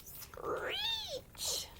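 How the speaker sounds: shrill high owl screech. Pretend. Owl swoops on mouse.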